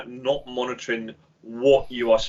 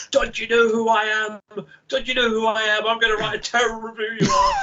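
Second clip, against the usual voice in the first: hammed-up outrage of a self-important man; mock threat from an editor about to be thrown; pompous editor; 11 semitones higher than usual. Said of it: "He was going".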